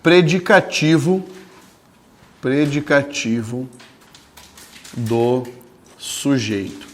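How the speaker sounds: slow, spelled-out dictation pace. Shown as a demonstration. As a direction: dictate each word slowly for notes. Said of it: "Coloca lá". (ditado lento)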